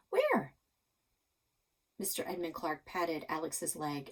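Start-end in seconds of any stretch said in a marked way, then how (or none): 0.1-0.5 eager. Acted. Eager question from Alex.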